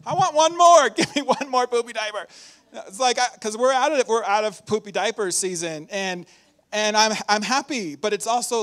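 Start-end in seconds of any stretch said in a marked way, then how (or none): 0.1-2.3 high, louder mock plea, laughing; mock pleading parent. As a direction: beg for it in jest. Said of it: "and you're like".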